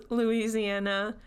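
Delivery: ordinary delivery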